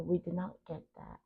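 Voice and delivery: normal talking voice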